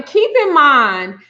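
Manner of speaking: ordinary talk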